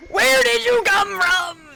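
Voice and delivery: high, drawn-out yell of fright; startled shout. Real, not acted. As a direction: shriek at a sudden scare. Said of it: "that vocal, that pullback was bad".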